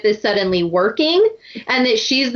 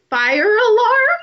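high, slow, puzzled question to the alarm; high bewildered query; puzzled Phoebe; 10 semitones higher, slower than usual. Retold or imitated. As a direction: ask the alarm earnestly, drawn out. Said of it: "and she's like".

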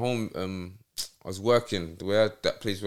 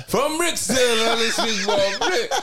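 laughing; 11 semitones higher, 5 dB louder than usual. Real, not acted. Laughing at being tied to Brixton.

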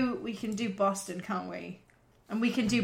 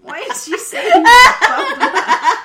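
high, loud, drawn-out laughing question; helpless laughter; 10 semitones higher, 13 dB louder, much slower than usual. Real, not acted. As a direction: wail it slowly while cracking up. Cracking up at the drawing.

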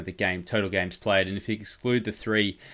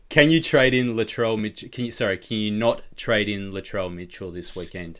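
ordinary talk, nothing marked

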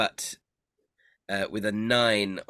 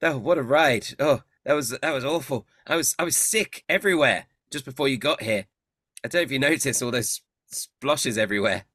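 shaken friend; 4 semitones higher, 4 dB louder than usual. Acted. GM voices rescued friend Agden.

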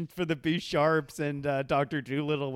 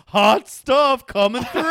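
higher, much louder, slow cartoon call; mock shout; 6 semitones higher, 10 dB louder, slower than usual. Worker carrying a load through the mill, hammed-up slow shout. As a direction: belt it out like a strutting worker. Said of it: "the steel worker with cut off jeans going".

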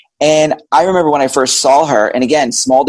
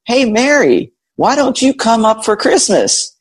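higher-pitched, drawn-out imitation of mom; mom's cheerful invitation; 10 semitones higher than usual. Mom's invitation voiced in story.

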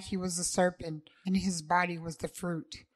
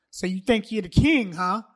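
taunting; 6 dB louder than usual. Acted. Davon taunts.

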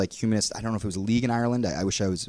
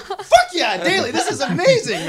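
high, loud, laughing cheer; thrilled, hyped shout through laughter; excited; 4 dB louder than usual. Retold or imitated. Answering Daly about partying till five, excited.

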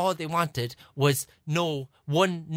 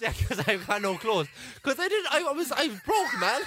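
higher, laughter breaking into the words; laughing; 6 semitones higher than usual. Real, not acted. Admitting to friend he was broke, laughing. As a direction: explain it while cracking up. Explaining through laughter why he asked.

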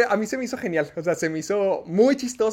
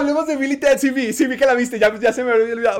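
bursts out much higher and louder, delighted; excited, much higher and louder, barely holding back; thrilled; 7 semitones higher, 8 dB louder than usual. Real, not acted. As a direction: pounce on the topic with real glee. Thrilled friend hears favourite film.

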